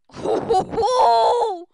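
loud burst of whoops; excited whooping. Real, not acted. Streamer reacting to a good pull, excited whoop. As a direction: whoop in rising delight.

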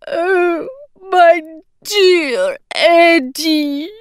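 high, slow, tearful; choked up. A narrator's reading. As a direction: choke the words through tears. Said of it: "choked out Mary".

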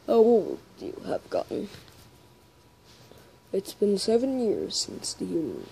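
lightly voiced, unhurried old acquaintance; Grillby impression. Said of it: "I'm gonna do Grillby".

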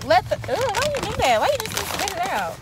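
high, loud disbelief; shocked outburst. Real, not acted. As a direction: react in sudden disgust. Shocked at spat gum.

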